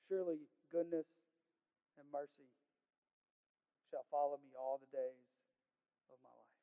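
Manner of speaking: soft, low, broken into short phrases with long pauses; hushed reverence. Real, not acted. Preacher repeating the song's most important part, hushed and reverent. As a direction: let each phrase of the verse sink in quietly.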